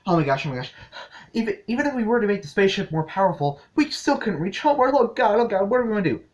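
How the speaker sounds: high, loud, fast panicked babble; imitating Pearl spiraling into panic; freaking out. Pearl panicking to the gems about reaching Homeworld, high and fast. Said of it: "Pearl is all freaking out".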